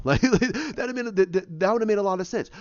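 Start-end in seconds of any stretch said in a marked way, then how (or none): none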